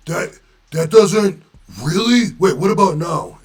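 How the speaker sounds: put-on high, loud whiny Boston accent of a friend. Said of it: "he was from Boston".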